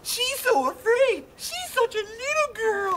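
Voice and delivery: very high, slow taunting; mocking high voice. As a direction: taunt like a schoolyard bully. Mocking the other.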